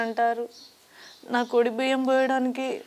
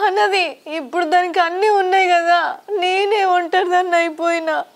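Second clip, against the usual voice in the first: tearful and sobbing, a little louder; crying; 4 semitones higher, 3 dB louder than usual. Acted. Sister cries to her family that she is left all alone. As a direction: weep through it, voice cracking. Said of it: "ఏడవక అక్క నువ్వు".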